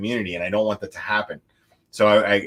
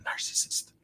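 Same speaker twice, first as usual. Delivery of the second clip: whisper; 10 dB quieter than usual. Acted. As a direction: whisper "narcissist." as a punchline. Punchline word dropped low.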